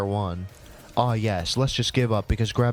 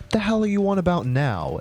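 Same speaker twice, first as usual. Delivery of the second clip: ordinary delivery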